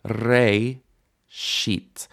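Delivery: BE, RE, SHIT each on its own, with pauses between; syllable by syllable. Shown as a demonstration. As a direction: split the word into three separate beats. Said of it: "three syllables".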